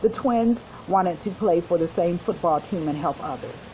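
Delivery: ordinary delivery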